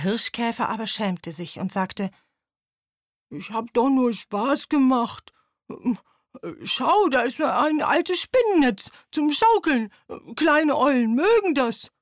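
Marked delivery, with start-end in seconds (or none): 3.3-11.9 put-on higher, louder coaxing old beetle; the ashamed beetle offering a cobweb swing, sheepish. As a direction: talk it down, embarrassed.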